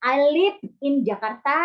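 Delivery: put-on playful Sundanese-accented demo. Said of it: "Meskipun kalian orang Sunda".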